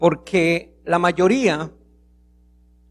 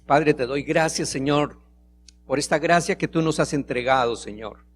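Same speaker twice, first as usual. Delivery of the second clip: soft, lower prayer voice; 6 semitones lower, 5 dB quieter than usual. Acted. Pastor praying to God for the congregation, soft and reverent. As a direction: pray quietly, humbly to the Lord.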